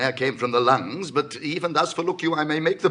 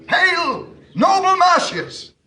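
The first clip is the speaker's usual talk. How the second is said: much higher, loud calling out; loud hail; 7 dB louder, slower than usual. Acted. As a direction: greet the general across the crowd. Hailing arriving general.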